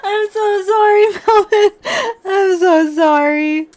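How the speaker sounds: high, loud laughing sorry; laughing apology. Real, not acted. Streamer laughing apologizes to Melvin for the voice. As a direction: giggle helplessly while apologizing.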